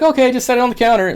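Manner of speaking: high, fast clerk imitation; postal clerk. Imitating the clerk waving his boxes through, high and quick. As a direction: rattle it off like a busy clerk.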